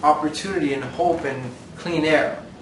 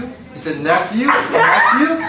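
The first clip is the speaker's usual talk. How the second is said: laughing; 5 semitones higher, 7 dB louder than usual. Real, not acted. Mocking the kid's odd word.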